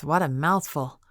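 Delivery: plain reading voice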